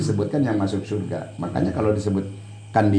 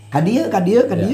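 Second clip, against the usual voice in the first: higher, sing-song Cianjur Sundanese call; 9 semitones higher, 3 dB louder than usual. Shown as a demonstration. Showing how Cianjur people say come here, in Cianjur Sundanese. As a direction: beckon in the Cianjur Sundanese lilt. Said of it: "di Cianjur sini".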